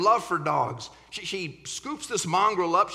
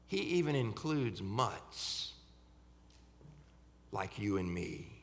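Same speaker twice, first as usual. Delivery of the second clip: tender and quiet; 8 semitones lower, 8 dB quieter than usual. Real, not acted. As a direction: drop low and gentle for the point. Tender sermon point after build.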